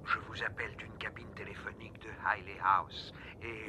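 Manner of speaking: hushed disguised whisper on the phone; breathy whisper to hide his voice. Acted. Anonymous call, disguised voice.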